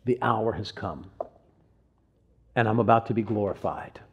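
hushed, weighty scripture quote; solemn quote. Retold or imitated. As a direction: let each phrase land quietly after a pause. Quoting Jesus solemnly.

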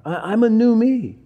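ordinary delivery

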